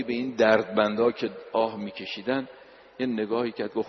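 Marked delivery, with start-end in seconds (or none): none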